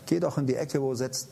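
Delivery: ordinary delivery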